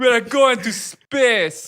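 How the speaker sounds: high, loud, stretched-out Jamaican impression; mock Jamaican accent. Imitating a friend who admired the drawing, Jamaican accent. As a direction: draw it out big in a Jamaican accent. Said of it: "I love the Jamaican".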